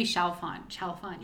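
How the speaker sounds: "Chalfont" as two syllables, CHAL-font. Shown as a demonstration. Host compares pronunciations with co-host, two-syllable "Chalfont". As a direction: say "Chalfont" with no vowel after the l. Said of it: "I love the way you say".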